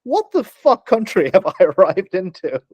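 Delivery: high, slow, laughing mock outrage; exasperated disbelief. Newcomer reading the firearms sign, exasperated disbelief. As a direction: stretch the bafflement out, breaking into a laugh.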